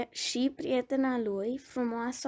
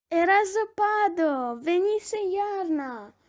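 high, louder cry of wonder; excited exclamation; 5 semitones higher, 5 dB louder than usual. A narrator's reading. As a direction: exclaim like an excited fawn, pitched up. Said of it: "exclamă puiul de căprioară". (exclamație încântată, cu voce înaltă)

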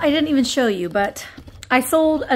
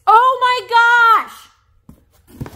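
excited gasp; 10 semitones higher, 5 dB louder, much slower than usual. Real, not acted. Thrilled at sheet music find.

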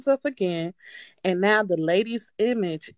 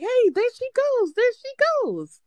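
high excited call, there she goes; yelling at ex. Retold or imitated. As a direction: point and call out to your ex. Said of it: "I'm yelling at my ex".

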